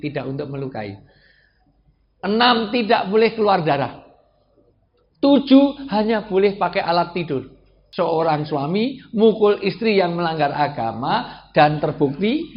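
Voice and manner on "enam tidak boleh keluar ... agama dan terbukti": raised, high emphatic listing; full-force preaching. Real, not acted. Preacher continues listing rules for husbands, emphatic preaching. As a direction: announce each rule with force.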